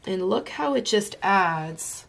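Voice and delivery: usual speaking voice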